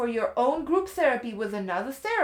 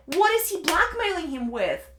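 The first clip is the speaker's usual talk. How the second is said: normal talking voice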